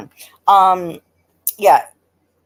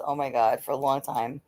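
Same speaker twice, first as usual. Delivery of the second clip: normal talking voice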